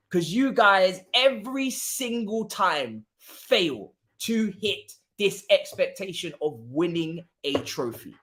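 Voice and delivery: normal talking voice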